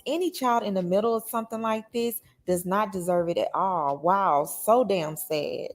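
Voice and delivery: usual speaking voice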